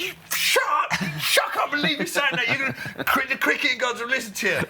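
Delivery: high, shouted, half-crying rant; furious outburst. Retold or imitated. Nasser yelling at a teammate for jinxing him, high shrill shout. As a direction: blow up at him in a squealing fury. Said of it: "He went mad at me".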